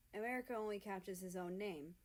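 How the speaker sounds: usual speaking voice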